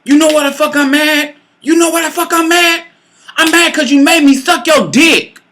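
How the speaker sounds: high-pitched, imitated indignant complaint; mock angry whining. Imitating lover telling her why he's mad, indignant.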